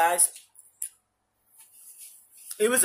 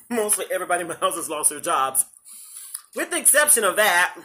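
ordinary talk

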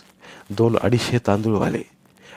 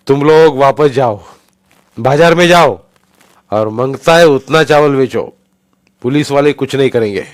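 loud, raised voice to a crowd; addressing the crowd; 5 semitones higher, 10 dB louder than usual. Acted. Saldhana telling the crowd to go back to the market, loud address. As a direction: project to calm a big crowd. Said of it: "म्हणाला".

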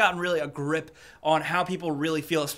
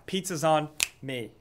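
cheesy line; 2 semitones lower, slower than usual. Pretend. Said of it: "That was stupid".

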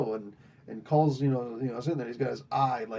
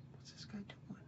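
hushed whisper; 7 semitones higher, 25 dB quieter than usual. Retold or imitated. Hiding from the advisor with the dagger, hushed whisper. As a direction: whisper so he won't hear.

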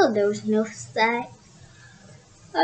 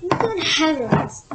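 mild mock shriek; 4 semitones higher, slower than usual. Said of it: "shrieked a voice".

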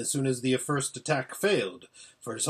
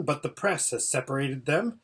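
ordinary delivery, nothing marked

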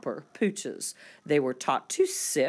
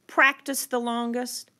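plain reading voice

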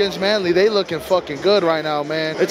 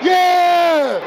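fan cheer; 10 semitones higher, slower than usual. Real, not acted. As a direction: yell for your team. Cheering at game.

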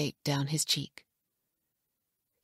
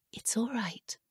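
put-on soft, breathy hush to calm the child; soothing whisper; 5 semitones higher, 6 dB quieter than usual. A narrator's reading. Anne soothing the startled baby, whispered softly. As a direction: whisper it gently so he isn't frightened. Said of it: "Anne soothed".

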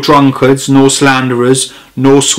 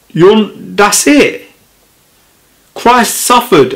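preaching much higher, fired up; heated preaching; 6 semitones higher than usual. Acted. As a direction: rail at the churches with rising fervour.

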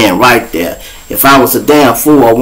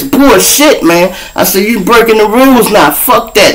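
snapping; 10 semitones higher than usual. Retold or imitated. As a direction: blow up at the cop. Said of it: "No, I snapped".

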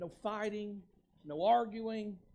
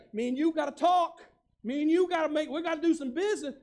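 a hint of a stern talking-to, loud; imitating a scolding sit-down; half-mocking stern summons; mock stern Jesus; 8 semitones higher, 12 dB louder, faster than usual. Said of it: "Jesus is not gonna look at you and say".

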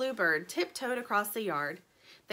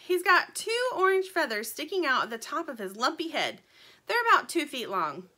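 lightly voiced bird, pitched up; indignant little bird; 6 semitones higher, 4 dB louder than usual. A narrator's reading. Little bird describing the thief to Dirt, lightly voiced and earnest.

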